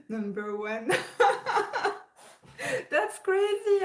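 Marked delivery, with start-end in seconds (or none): 1.2-3.8 high, loud, teary burst of disbelief; a delighted gasp near tears; overwhelmed. Real, not acted. Told she is the top reactor, a high teary burst of disbelief. Learning she is number one.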